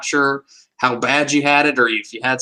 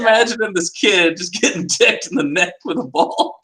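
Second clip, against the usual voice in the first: high, laughing through the words; can't stop laughing while telling it; 7 semitones higher than usual. Real, not acted. Friend laughing at the image of the kid. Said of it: "laughing while telling".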